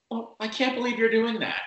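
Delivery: mock an outraged Karen. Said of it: "for a Karen to be like".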